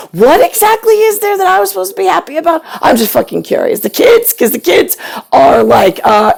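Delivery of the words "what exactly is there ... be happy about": much higher, fast, shrill-edged demand; a mother venting at her son; exasperated rant. Real, not acted. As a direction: fire it off in disbelief.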